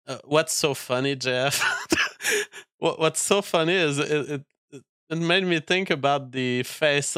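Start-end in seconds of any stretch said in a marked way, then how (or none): 1.7-2.6 short squeaky breathless giggle; can't hold back a laugh; quick high giggle. Real, not acted. Laughing at co-host's amusement.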